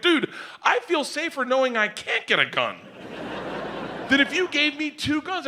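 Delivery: very high-pitched, giddy relief; imitated hysterical giddiness. Customer to his arms dealer, mock hysterical high voice. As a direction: act out being helpless with giddy delight. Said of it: "I looked at him laughing hysterically".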